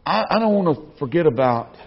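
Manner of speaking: ordinary delivery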